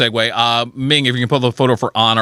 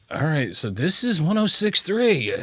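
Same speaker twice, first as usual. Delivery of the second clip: retold wary look-around; 3 semitones higher, 4 dB quieter, slower than usual. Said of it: "kinda looking around and kinda going".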